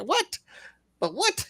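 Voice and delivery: high rising bafflement; incredulous. Pretend. Host baffled by commenter, incredulous. Repeats disbelief.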